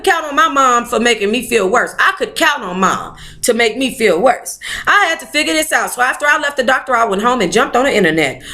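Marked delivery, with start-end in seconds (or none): none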